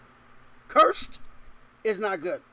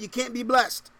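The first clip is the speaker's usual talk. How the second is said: ordinary talk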